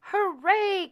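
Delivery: high loud cheer; squeaky mouse cheer. A narrator's reading. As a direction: mice cheering for Cinderella. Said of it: "cried the mice".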